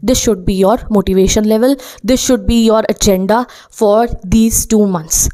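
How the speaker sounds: very slow, each word laid out deliberately; teacher slowing right down for the key message; slow emphatic slogan. Shown as a demonstration. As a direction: spell out the motto word by word to sink in. Teacher states motivating slogan.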